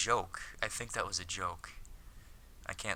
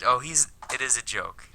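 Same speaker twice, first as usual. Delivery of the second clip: ordinary talk, nothing marked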